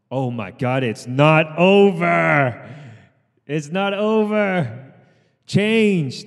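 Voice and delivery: it's not over, dragged slow and loud; loud slow rallying cry; dramatic shout. Real, not acted. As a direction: stretch it out to rally the class. Coach announces set continues.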